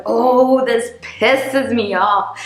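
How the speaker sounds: a hammed-up flash of irritation, high and slow; mock angry outburst. Speaker acting out her own angry inner voice, drawn-out mock irritation. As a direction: blurt it out like your anger just flared up.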